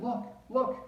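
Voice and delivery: high, loud alarmed look look; a panicked fish crying out a warning; frightened fish. Acted. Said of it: "our fish shook with fear".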